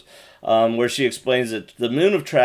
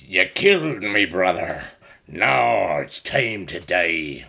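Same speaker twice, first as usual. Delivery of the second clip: low, slow put-on Quarren alien accent; 4 semitones lower, slower than usual. Podcast host voicing the Quarren thug, low slow Quarren alien accent. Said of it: "I just want to use the accent".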